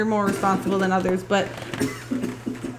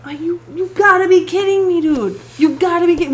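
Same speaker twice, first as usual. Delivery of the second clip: exasperated; 6 semitones higher, 4 dB louder than usual. Retold or imitated. Exasperated outburst at son.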